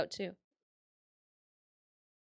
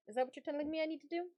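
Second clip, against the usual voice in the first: usual speaking voice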